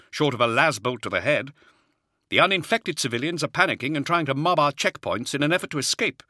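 a harried Jaeger officer reporting under pressure; Hadziel, harried officer. Acted. Officer's voice held by narrator.

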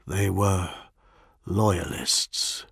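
ordinary delivery, nothing marked

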